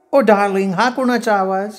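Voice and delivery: high woman's voice; curious sweetheart. A narrator's reading. Woman asking her darling about a noise, high curiosity. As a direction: ask in a high, put-on woman's pitch.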